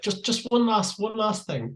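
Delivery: imitating a polite, persistent tour manager; nice pleading Chris. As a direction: ask sweetly for one last thing. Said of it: "it was always done in that real nice way".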